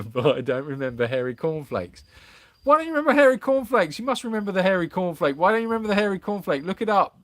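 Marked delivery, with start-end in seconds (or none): 2.6-7.2 high, fast mock outrage at the chat; mock indignant. Teasing the chat with mock outrage, high and fast. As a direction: pretend disbelief, pitched up and rushed.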